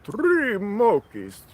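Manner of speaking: slow, very high vampire count voice; Count impersonation. Pretend. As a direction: drag out the count like Dracula. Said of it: "count impersonation".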